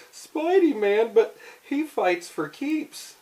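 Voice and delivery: high, slow frightened cry; scared. Acted. Villains hear they must fight Spidey, high scared cry. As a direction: gasp it out high and slow in fear. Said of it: "They're all scared".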